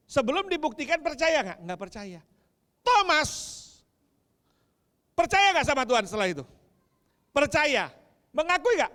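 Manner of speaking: high, loud fiery preaching; preaching at full force. Acted. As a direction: proclaim it to the congregation with full passion. Loud call and response. (khotbah berapi-api dengan suara lantang)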